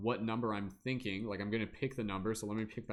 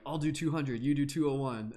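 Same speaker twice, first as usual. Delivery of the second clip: playful quote; 5 semitones higher, 6 dB louder than usual. Retold or imitated. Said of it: "They're like".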